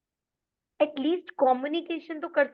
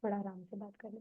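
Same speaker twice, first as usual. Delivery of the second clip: much softer, gentle and calm; quiet reassuring plea; soft, at ease; 4 semitones lower, 14 dB quieter than usual. Shown as a demonstration. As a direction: soothe someone who is shouting. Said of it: "जब तुम बात east में करो".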